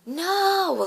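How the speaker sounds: high, loud drawn-out "no" cry; retold scream. Dreamer crying out as the wave hits everyone, screaming. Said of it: "I screamed".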